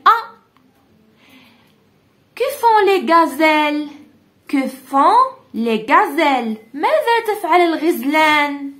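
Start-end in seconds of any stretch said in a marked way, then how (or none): 2.3-6.6 slow, stretched "Que font les gazelles?" with stress on "font"; slow question reading. Shown as a demonstration. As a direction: read the question clearly and slowly to the class. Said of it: "teacher reads question slowly".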